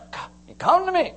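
high, loud coaxing call; excited beckoning. Acted. Preacher acting the call to Peter on the water, excited beckoning. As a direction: call them over, thrilled and loud.

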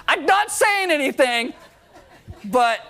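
high, loud laughing denial. Real, not acted. Pastor laughing at his dog's only victim, loud and high. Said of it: "laughing at own dog joke".

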